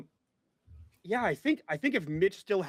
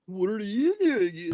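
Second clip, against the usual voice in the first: put-on Californians accent; 2 semitones higher than usual. Player joking about the Californians, exaggerated Californian accent. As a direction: ham up a comic Californian accent. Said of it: "all I can think of is the Californians".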